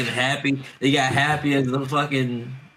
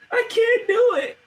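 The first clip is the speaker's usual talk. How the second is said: much higher, teary helpless laughing; real laughter; much slower than usual. Real, not acted. Cohost breaking down laughing at a scene, helpless and teary. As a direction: laugh until you can barely speak. Laughing so hard it sounds like crying.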